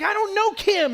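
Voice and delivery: high-pitched mock exasperation; retold protest. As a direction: act out a baffled protest, pitched well up. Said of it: "I'm like".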